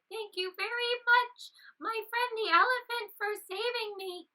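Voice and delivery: high, bright thanks from a tiny rabbit; little rabbit thanking the elephant for saving him; grateful little rabbit. Acted. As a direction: squeak out heartfelt thanks. Grateful rabbit voiced in story.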